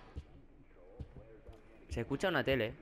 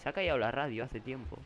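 normal talking voice

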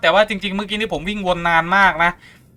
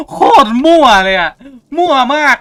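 laughing out loud, higher, at the mess; 10 semitones higher, 7 dB louder than usual. Real, not acted. Gamer laughing at a pointless puzzle, loud and high. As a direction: crack up at how random it is.

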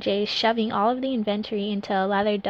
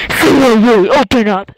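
loud screaming man; 15 dB louder than usual. Acted. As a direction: yell it through the door. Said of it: "A man screamed".

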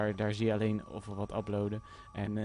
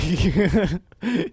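high, bouncing laugh; 5 semitones higher, 8 dB louder than usual. Real, not acted. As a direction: burst into a loud, high chuckle. Laughs at own game remark.

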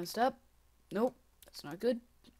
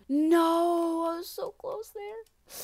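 frustrated cry; 9 semitones higher, 12 dB louder, much slower than usual. Real, not acted. Near miss in the game.

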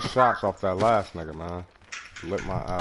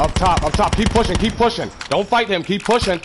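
higher, louder push call; urgent shout; 6 semitones higher, 3 dB louder than usual. Real, not acted. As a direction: drive the team forward. Urging team forward mid-fight.

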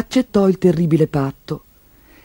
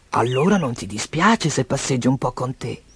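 gentle beast voice; 4 semitones lower, 7 dB quieter than usual. A narrator's reading. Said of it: "chiese la bestia".

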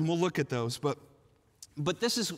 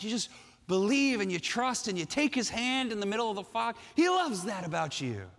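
normal talking voice

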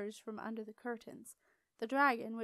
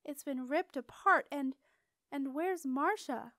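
alarmed; 4 semitones higher, 6 dB louder than usual. A narrator's reading. Septimus alarmed at the wreck.

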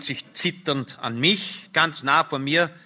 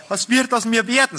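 high, agitated lamenting question; wailing lament; 10 semitones higher, 4 dB louder than usual. A narrator's reading. Wife fearing for herself, high wailing lament. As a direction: wail it in distress. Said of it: "klagte meine Frau".

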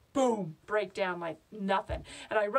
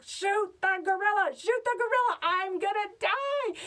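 panicked screaming; 10 semitones higher, 4 dB louder than usual. Acted. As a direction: scream to someone far off to save you. Screaming to man for rescue.

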